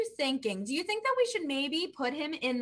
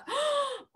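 excited gasp; 12 semitones higher, 4 dB quieter than usual. Acted. Looks in the bag.

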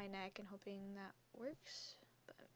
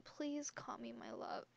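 normal talking voice

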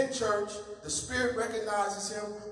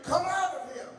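higher, firm rebuke; stern; 6 semitones higher than usual. Acted. Jesus rebuking the spirit, sternly. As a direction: command the spirit out. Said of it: "Jesus said sternly".

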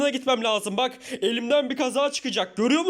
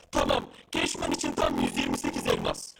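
deep guard voice; 5 dB quieter than usual. Acted. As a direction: sound like a machine-voiced gatekeeper. Guard names the toll.

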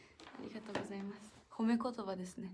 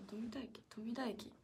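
whispered to herself; 9 dB quieter, slower than usual. Real, not acted. Idol whispering a station name to herself while reading comments. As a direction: murmur the name under your breath, unvoiced.

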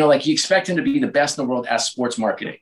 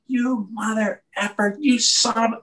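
mock boss cursing; 9 semitones higher than usual. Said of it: "it's like".

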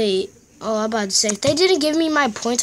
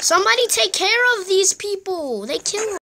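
urgent plea; 7 semitones higher than usual. Real, not acted. Urging teammates on in play.

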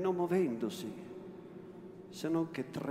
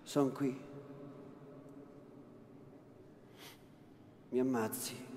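terrified surrender; 2 semitones lower, 8 dB quieter than usual. A narrator's reading. Lucia kneeling before her captor, quiet and terrified. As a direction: give yourself up, barely voiced.

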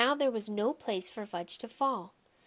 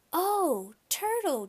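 put-on high, wondering child voice; high-pitched girlish delight; little girl marveling; 9 semitones higher, slower than usual. A narrator's reading. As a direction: coo it like an amazed small child.

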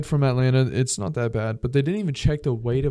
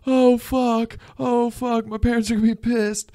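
high, panicked whining over his brother; hammed-up shock at the horror; mock panic; 11 semitones higher, slower than usual. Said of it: "And you're like".

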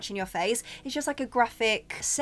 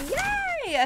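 excited yay; 3 dB louder than usual. Real, not acted. Relieved last item from bag.

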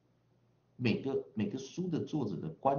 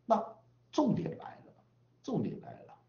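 ordinary delivery

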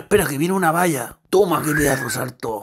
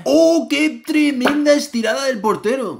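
excited commentator; 10 semitones higher than usual. Acted. Mock TV commentator call.

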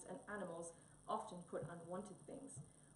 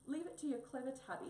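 higher, louder self-important tone; importantly; 6 semitones higher, 4 dB louder, faster than usual. Acted. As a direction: puff up like the clever one. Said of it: "said Tabby importantly".

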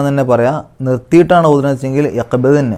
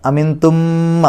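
slow, drawn-out tajweed chant, pitch raised; Quran recitation; 4 semitones higher, much slower than usual. Shown as a demonstration. Teacher chants the verse before explaining it. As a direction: chant the verse slowly for the students. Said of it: "രണ്ട് മീമുകൾ അടുത്തടുത്ത് വന്നു".

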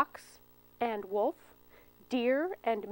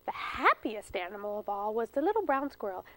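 ordinary delivery, nothing marked

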